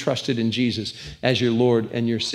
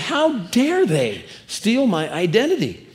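mock indignation; 6 semitones higher, slower than usual. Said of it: "I was ticked off".

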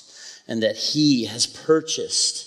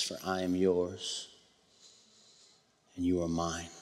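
low, soft, unhurried praying with long pauses; quiet prayer; 3 semitones lower, 6 dB quieter than usual. Real, not acted. Pastor praying over the congregation after a worship song, quiet reverent prayer. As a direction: pray softly and low, letting each phrase rest.

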